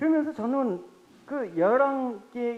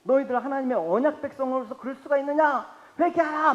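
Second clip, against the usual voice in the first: raised, scolding call to repent; rebuking prophet; 5 semitones higher, 3 dB louder than usual. Retold or imitated. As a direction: thunder at the people like a prophet. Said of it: "막 백성들을 꾸짖는 거야".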